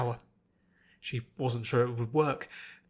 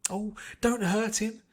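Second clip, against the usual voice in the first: high, slow pleading cry; yelped plea; 8 semitones higher, slower than usual. A narrator's reading. Fluttershy begging Applejack not to hurt the wolf, frightened. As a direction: beg them to stop, frightened. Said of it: "Fluttershy yelped".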